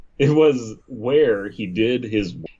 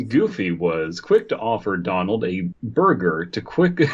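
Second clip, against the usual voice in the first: usual speaking voice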